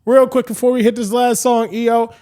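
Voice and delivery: high, loud call to the crowd; rally everyone like a hype DJ; stage announcement. Retold or imitated. DJ calls crowd forward for a photo, loud announcement.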